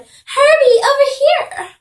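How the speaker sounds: loud, high-pitched call; mother's shout. Acted. Mother calling her son from the car, shouting. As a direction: holler it high across the street. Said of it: "Missus McFish got out of her car and drove and shouted".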